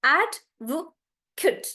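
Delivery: "Advocate" very slow, syllable by syllable; stress on AD. Shown as a demonstration. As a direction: stretch "AD", then small "vuh", "kut". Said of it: "everything else becomes smaller".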